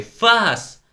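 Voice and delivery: high, loud one-word cry; shouted command. A narrator's reading. Pupils egging on a scuffle, shouted command. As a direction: shout it like setting a dog on someone. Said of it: "можна було б покричати".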